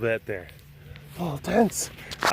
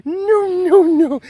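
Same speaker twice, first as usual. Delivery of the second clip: high, loud mock panic shouting no; high loud alarmed no, told as a story; retold panicked no; 6 dB louder, much slower than usual. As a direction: reenact yelling no at the friend, pitched high. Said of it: "I'm like".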